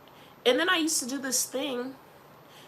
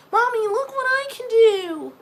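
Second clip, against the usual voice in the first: put-on high, loud whiny child voice; mock kid voice; 11 semitones higher, 11 dB louder than usual. Mom imitating her child begging her to watch, squeaky. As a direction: play the bragging little kid.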